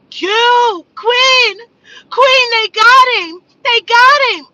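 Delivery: imitating a woman's high, loud, sobbing panic on the phone; hysterical crying. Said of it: "call me crying, hysterical".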